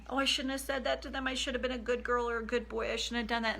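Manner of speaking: high, rushed, anxious self-blame; panicked wounded child. Acted. Coach voicing the activated child's regret, panicked and rushed. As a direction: fret fast and high like a scolded kid. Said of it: "the child gets activated".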